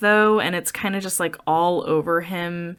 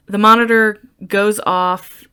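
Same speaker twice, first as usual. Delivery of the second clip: ordinary talk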